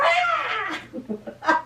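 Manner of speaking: high, drawn-out fake wail; hammed-up crying howl; mock blubbering. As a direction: bawl like a sad storybook bear. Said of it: "the bear blubbers on".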